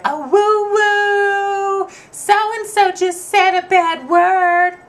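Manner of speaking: high, slow sing-song tattle; tattling kid. Retold or imitated. Eight-year-old boy tattling to grandma in a high sing-song. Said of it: "being like".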